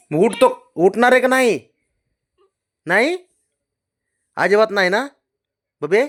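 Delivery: high, loud scolding; demanding. Real, not acted. Owner ordering the dog off the bed, high and loud.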